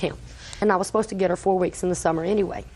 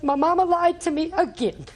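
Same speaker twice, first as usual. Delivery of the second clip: put-on high, tearful child's voice, lightly done; imitating crying child; 8 semitones higher than usual. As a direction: voice a heartbroken granddaughter. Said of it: "she was crying so hard".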